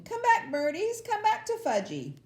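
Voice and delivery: put-on screaming toddler. A narrator's reading. Fudge screaming at the birds, high and loud. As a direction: shriek after the birds. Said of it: "was screaming".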